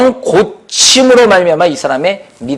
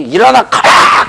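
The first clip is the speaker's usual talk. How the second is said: slow, raised, urgent cry; fervent command; 4 semitones higher, 3 dB louder, slower than usual. Retold or imitated. Paul so earnest the lame man jumps up, fervent command. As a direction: stretch out the order with fervour. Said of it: "얼마나 간절했던지".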